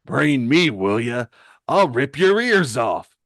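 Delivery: angry villain's loud threat; Mad Dog. Acted. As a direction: shout it like an enraged brawler. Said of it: "Mad Dog picks himself off the floor".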